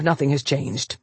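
plain reading voice

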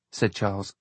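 ordinary delivery, nothing marked

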